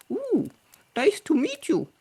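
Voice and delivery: very high, tiny pursed-lip squeak; closed-lipped frog. Acted. Frog hiding his mouth from the heron, pinched and high. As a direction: squeeze it through nearly shut lips. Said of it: "closes his lips and says".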